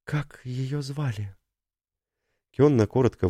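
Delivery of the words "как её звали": slow, soft, numb-lipped; faltering quiet question. Acted. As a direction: barely push the words out. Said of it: "онемевшие непослушные губы".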